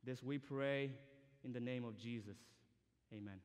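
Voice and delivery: usual speaking voice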